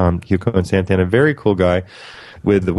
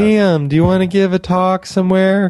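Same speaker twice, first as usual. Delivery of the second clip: imitating Nicole's voice; 9 semitones higher than usual. Imitating Nicole asking him to speak somewhere, high and eager. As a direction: pitch it way up like her. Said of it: "that's how she talks".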